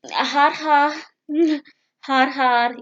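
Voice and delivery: loud sarcastic har har with real laugh; fake-laugh at yourself; mock laugh. Real, not acted. Laughing off the Arabic, loud har har. Mocking own failure.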